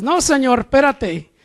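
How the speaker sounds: insistent mock protest; acted protest. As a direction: push back as if halting someone. Acts out resisting God.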